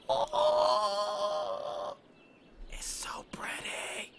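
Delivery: awed whisper. Real, not acted. Awed at sunset.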